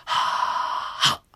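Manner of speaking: unvoiced ha, then a sharp stop; breathy ha exhale. Shown as a demonstration. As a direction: breathe out ha and cut it. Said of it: "はっとこう止めると".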